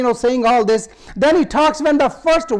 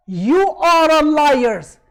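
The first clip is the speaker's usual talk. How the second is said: slow, higher-pitched emphatic rebuke; retold accusation, each word drawn out; firm retort; 4 semitones higher, 4 dB louder, much slower than usual. Khadija defending her husband against Quraysh, firm retort.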